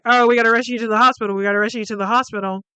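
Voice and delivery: fast, hurried panic; quick mock panic to get him to hospital; urgent rush. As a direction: rattle it off in a hurry. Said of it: "[name]'s freaking out".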